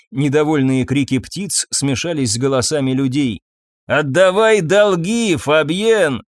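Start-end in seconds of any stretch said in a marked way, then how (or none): 3.9-6.2 slow, drawn-out demanding call; raised, higher menacing shout. A narrator's reading. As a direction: holler it from outside, stretching the words. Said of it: "крикнули снова".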